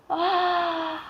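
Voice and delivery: sharp quiet gasp of surprise; a sudden intake of breath in shock; a soft startled gasp. Acted. Shock at gold apple.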